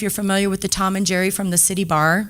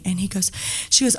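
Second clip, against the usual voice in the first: ordinary talk, nothing marked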